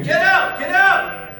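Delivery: high yelled get out; screaming at the TV. Retold or imitated. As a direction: scream it at the game. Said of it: "I start screaming".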